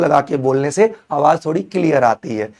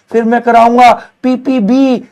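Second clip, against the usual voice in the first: higher and louder, selling the topic like a big reveal; hyped announcement; 7 semitones higher, 5 dB louder than usual. Acted. Teacher hyping his class about the next topic, excited. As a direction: build it up, this one's a showstopper.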